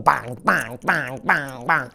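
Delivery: low, thudding bang bang; hammer bangs. A narrator's reading. Storyteller sounding out Missus MacDonald's hammering, low and thudding. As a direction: knock out each bang like a hammer.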